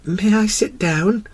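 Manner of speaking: high, slow, small voice; hesitant, high-pitched child prince; timid little prince. A narrator's reading. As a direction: ask timidly as a young boy. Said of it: "timidly inquired".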